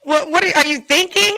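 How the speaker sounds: high, loud mock exasperation; mock scolding. Host teasing the problem child of the panel, mock scolding.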